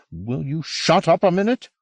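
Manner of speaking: exasperated interruption. A narrator's reading. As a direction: cut in sharply to stop his rant. Will cuts off the man's outburst.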